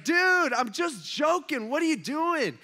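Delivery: high, loud panicked protest; hammed-up high, alarmed whine; mock freaking out. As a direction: imitate the pinned kid protesting, voice way up. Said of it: "kind of freaking out".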